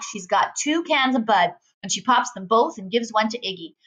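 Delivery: ordinary delivery, nothing marked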